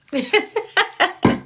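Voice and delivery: bright high burst of laughter. Real, not acted. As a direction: let out a high delighted laugh. Laughs after loving it.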